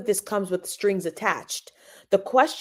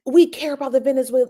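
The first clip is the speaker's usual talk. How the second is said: mocking liberal voice; 5 semitones higher, 4 dB louder, faster than usual. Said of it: "And they're like".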